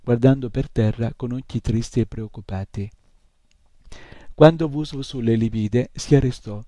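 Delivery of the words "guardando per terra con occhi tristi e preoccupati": plain reading voice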